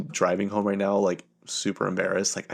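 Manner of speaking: usual speaking voice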